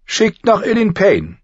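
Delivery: high, loud command; imperious queen. A narrator's reading. Cersei sending for Ilyn Payne, imperious. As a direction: give the order high and commanding. Queen orders the executioner fetched.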